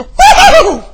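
high shouted woo; joyful whoop. Shown as a demonstration. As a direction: let out a happy holler. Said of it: "just have a little fit".

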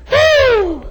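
high, sudden preacher's whoop; excited whoop. Real, not acted. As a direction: let out a real revival "woo". Preacher whoops after retort.

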